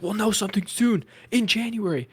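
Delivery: high-pitched mock announcement; James Gunn. Host mocking the studio head's vague updates, high mock voice. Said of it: "James Gunn is like".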